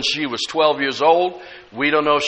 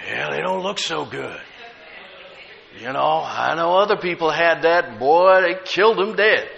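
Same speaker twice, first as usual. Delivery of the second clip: negative cousin. Retold or imitated. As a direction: imitate a doubting relative at the bedside. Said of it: "He's so negative, so full of unbelief and doubt".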